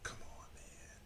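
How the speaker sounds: soft whispered grumble; muttered to self. Retold or imitated. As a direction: whisper it under your breath at the rice. Muttering at own undersalted rice.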